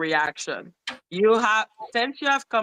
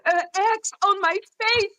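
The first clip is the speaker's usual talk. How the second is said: shocked high squeak; 12 semitones higher than usual. Real, not acted. Shocked retelling.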